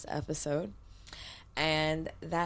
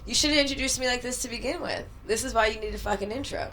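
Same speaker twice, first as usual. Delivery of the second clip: energetic intro; 6 semitones higher, 3 dB louder, faster than usual. Real, not acted. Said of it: "This is my sexy voice".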